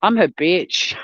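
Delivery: ordinary delivery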